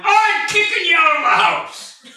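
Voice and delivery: hammed-up threat to a kid, half laughing; mock angry parent. Parent scolding child on bike.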